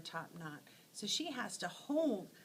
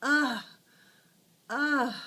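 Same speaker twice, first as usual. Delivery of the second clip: loud higher strained grunts; effortful ugh; 5 semitones higher, 11 dB louder than usual. Acted. Teacher voicing a struggling bird's effort. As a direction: strain it out like hard work. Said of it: "she's going".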